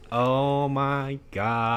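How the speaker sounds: put-on high, very slow Valley Girl drawl; Valley Girl accent. Friend complains about West Coast talk, Valley Girl accent. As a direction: drag it out, mocking a Valley Girl. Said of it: "Why can't they just talk normally?".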